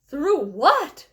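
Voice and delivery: loud alarmed call; shout. A narrator's reading. Grandpa Joe asks Wonka in alarm, shouting. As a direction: bark the question in alarm. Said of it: "shouted Grandpa Joe".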